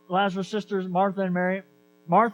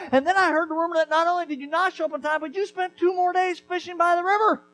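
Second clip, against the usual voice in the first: much higher, loud, scolding rant; furious sister scolding Jesus for coming late; angry reproach; 10 semitones higher, 4 dB louder than usual. Acted. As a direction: let her rip into him for being late. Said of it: "this is anger at Jesus".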